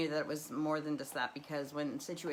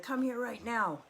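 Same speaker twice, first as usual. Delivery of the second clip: higher, firmer father's command; retold dad ordering her over; insistent dad; 8 semitones higher than usual. Dad insisting during fight.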